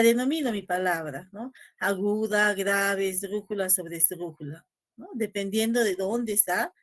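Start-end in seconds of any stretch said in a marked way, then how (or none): none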